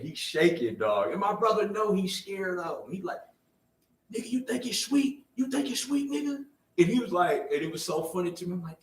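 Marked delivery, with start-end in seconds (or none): 4.1-6.4 high, mocking threat; imitating threat. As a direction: taunt him from above. Brother threatening stepdad.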